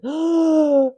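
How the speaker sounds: a big sharp gasp for air. Retold or imitated. Retelling a religious experience, sharp gasp. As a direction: gasp in like you're about to die.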